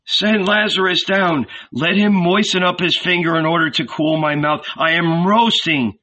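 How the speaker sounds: high, agonized plea; crying out. A narrator's reading. Rich man begs Abraham for water, crying out in torment. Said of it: "he cried".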